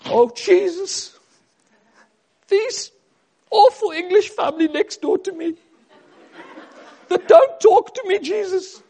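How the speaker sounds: high, loud mock prayer in character; hammed-up neighbour praying at full volume; Magda. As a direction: pray loudly in a high caricature voice. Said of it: "I could imagine Magda baking those cakes".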